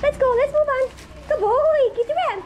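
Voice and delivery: high, loud coaxing call to a dog; squeaky praising a dog. Real, not acted. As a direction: sing out to the dog.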